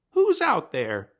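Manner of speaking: high, loud calling; high call to the stranger; Coyote calling out. Acted. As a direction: shout through the wood. Coyote calls out from tree.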